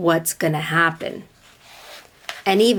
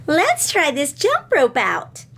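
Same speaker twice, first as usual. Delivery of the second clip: put-on cheerful little girl; 8 semitones higher than usual. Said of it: "sang Katy Sue".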